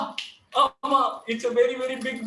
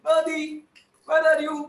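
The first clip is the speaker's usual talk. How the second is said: normal talking voice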